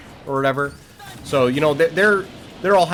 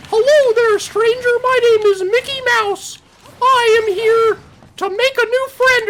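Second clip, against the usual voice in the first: very high, loud cartoon falsetto; Mickey Mouse; 5 dB louder than usual. Cartoon mouse greeting a stranger at the door, high friendly falsetto. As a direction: introduce yourself as a squeaky cartoon mouse. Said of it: "so Mickey's like".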